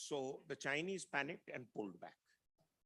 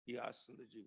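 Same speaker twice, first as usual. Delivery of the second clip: ordinary delivery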